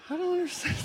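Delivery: high, soft, faked confusion; mock bafflement. Preacher feigning confusion to the congregation, high and soft. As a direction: play dumb in a small, high voice.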